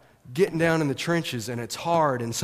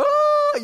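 a sudden high yelp of excitement; excited shout; 7 dB louder than usual. Real, not acted. Pastor reliving the game with the congregation, excited yelp. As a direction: shout it out like the winning play just happened.

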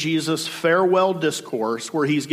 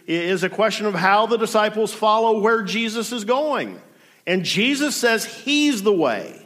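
normal talking voice

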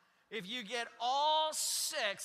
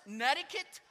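ordinary talk, nothing marked

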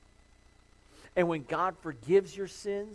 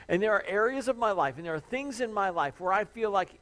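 louder, higher pulpit build; fervent preaching; 6 semitones higher, 6 dB louder than usual. Acted. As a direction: drive it home from the pulpit. Preaching with rising force.

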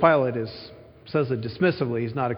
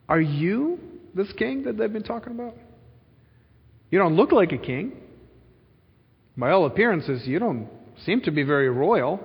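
incredulous questioning, "you" pitched up; surprised Pilate; 5 semitones higher than usual. Acted. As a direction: size him up in disbelief. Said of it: "There's a surprise here".

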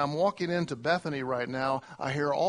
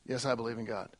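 quiet mock answer; 7 semitones lower, 7 dB quieter, faster than usual. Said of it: "they say".

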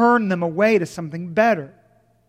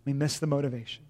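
quiet repeat of "We miss the motivation" for weight; softer echo; 5 semitones lower, 10 dB quieter, faster than usual. Shown as a demonstration. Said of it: "repeated line".

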